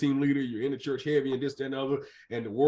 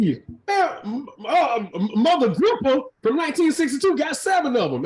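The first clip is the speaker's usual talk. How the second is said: animated rant; 10 semitones higher, 5 dB louder than usual. Real, not acted. Host ranting about church ladies.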